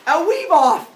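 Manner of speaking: high, loud proclamation; excited announcement. Acted. Storyteller announces the weaving contest, loud excited call. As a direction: shout it like a big showdown announcer.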